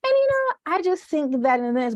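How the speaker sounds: very high, polite classroom voice; high proper voice. Retold or imitated. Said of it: "I'm like".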